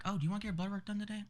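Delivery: high, quick clinic worker voice. Retold or imitated. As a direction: imitate her in a high, light voice. Retold question from clinic worker.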